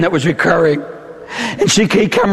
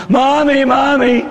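put-on child voice; 3 semitones higher, much slower than usual. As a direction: whine like a hurt small child. Child calls to mother.

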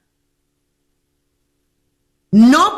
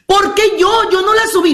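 high-pitched acted objection; mock-aggrieved wife, pitched up; indignant protest; 9 semitones higher than usual.

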